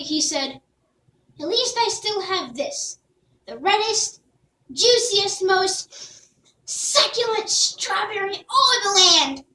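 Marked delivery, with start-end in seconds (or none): 1.4-9.4 slow, higher, swelling pride; Jack, brave. Acted. Jack holding up the strawberry, slow and proud. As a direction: talk himself into hope.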